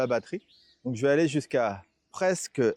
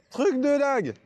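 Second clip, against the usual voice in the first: amazed; 10 semitones higher than usual. Real, not acted. Amazed at the climb.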